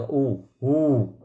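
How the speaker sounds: ऊ, हू with a slow, drawn-out oo; long oo vowel. Shown as a demonstration. Teacher demonstrating the long vowel, ऊ, हू held long. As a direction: stretch the vowel in ऊ, हू. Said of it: "sound थोड़ा लंबा हो जाएगा".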